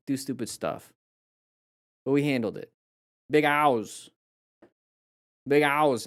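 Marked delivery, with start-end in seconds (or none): 3.3-4.1 put-on playful Boston-accented nickname. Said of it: "say it with a Boston accent". 5.5-6.1 put-on playful Boston-accented nickname. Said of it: "say it with a Boston accent".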